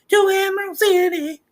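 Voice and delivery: put-on high young girl voice, curious; Dorothy's girl voice. Girl asking about Oz, high and curious.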